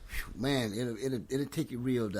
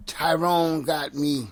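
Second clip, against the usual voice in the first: slow loud accusing; slow emphatic blame; 7 dB louder, much slower than usual. Retold or imitated. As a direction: drag it out, pin it on Tyrone. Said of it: "so when Corey say".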